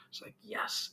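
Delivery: a voiceless whispered yes of relief. Real, not acted. Relieved to hear the cat was found, whispered. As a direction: breathe it out, no voice.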